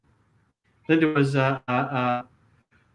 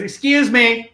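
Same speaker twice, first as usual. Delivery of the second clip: higher and a bit louder, pointedly polite; sharp objection; 8 semitones higher, 4 dB louder, slower than usual. Retold or imitated. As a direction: call out to stop him, tartly. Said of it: "and Susan goes".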